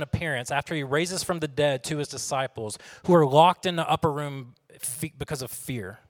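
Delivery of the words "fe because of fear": whispered. Acted. Whispered fear in sermon.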